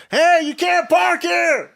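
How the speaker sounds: high, slow mock yell; mock shout. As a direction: bark it like an angry clerk. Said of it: "then it becomes".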